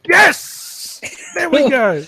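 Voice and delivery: loud, high cheer of relief; excited shout. Real, not acted. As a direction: yell it in triumph. Player finally lands a shot.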